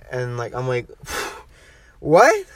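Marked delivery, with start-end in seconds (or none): 2.0-2.4 high, loud squeaked disbelief; baffled high yelp; acted bewilderment. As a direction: shoot the pitch up in mock shock. Acting out shocked wake-up reaction.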